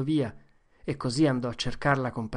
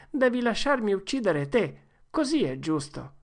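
higher, insistent; demanding boy; 7 semitones higher than usual. Acted. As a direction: claim your fair turn. Joe demands his turn. (Joe reclama il suo turno)

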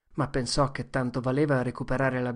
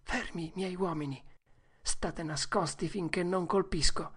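softer, careful order to hidden men; cautious hush; 4 semitones higher, 5 dB quieter than usual. Acted. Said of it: "Disse cautamente". (ordine cauto e sommesso)

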